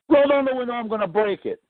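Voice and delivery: high, barking cop command; mock cop barking an order; cop's order. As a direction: mimic the angry trooper. Retold cop threatening.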